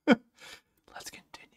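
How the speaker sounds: laughing whisper. Real, not acted. Embarrassed after fumbling the game.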